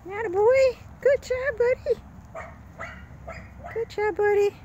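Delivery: high squeaky praise for a pup; squeaky praising a dog. Real, not acted. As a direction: cheer the puppy on up high.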